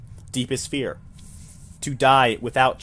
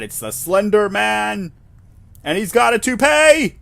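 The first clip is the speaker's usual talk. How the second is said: much higher and louder, slow then bursting; excited exclamation; 9 semitones higher, 7 dB louder, slower than usual. Real, not acted. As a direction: announce it with mock shock. Excited joke about the figure.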